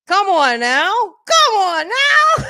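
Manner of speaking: building to a high, loud shout; preacher's rising call. Pretend. Closing her livestream with a rising preacher shout. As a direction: whip the church up.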